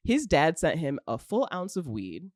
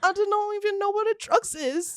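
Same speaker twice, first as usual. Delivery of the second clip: sweet cute act; 14 semitones higher, 4 dB louder than usual. Pretend. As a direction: play the innocent airhead for the cops. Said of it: "sweet cuteness and being like".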